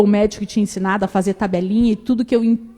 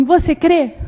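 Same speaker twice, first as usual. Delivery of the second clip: firm question; 7 semitones higher, 8 dB louder, slower than usual. Retold or imitated. Grandmother questioning firmly.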